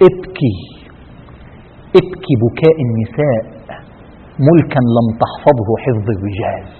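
low, slow mother's reproach, lightly acted; grave retold rebuke. Mother telling the last king of Andalus to weep, grave. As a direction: quote her slowly and heavily, voice held low.

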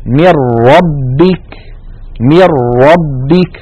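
من ربك recited slowly as "mir-rabbik" with a doubled رّ and no nun; slow Quranic chant of من ربك, nun dropped; from nun straight into a rolled doubled ra; idgham into ra. Shown as a demonstration. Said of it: "مثال الراء".

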